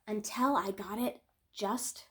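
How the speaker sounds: gentle picture-book read-aloud, lingering on key moments; storytelling. Acted. Reading a children's book to her viewers, gentle storytelling. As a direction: tell it like a bedtime story for kids.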